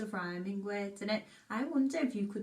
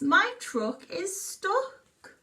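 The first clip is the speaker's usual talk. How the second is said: duck snaps; 9 semitones higher, 5 dB louder, much slower than usual. Acted. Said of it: "he snaps".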